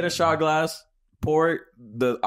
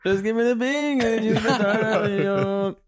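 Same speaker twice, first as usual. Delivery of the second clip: high, drawn-out mock Jamaican accent; 9 semitones higher, slower than usual. Friend riffing on buddy's slip, mock Jamaican. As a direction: stretch it out in a hammed-up Jamaican lilt.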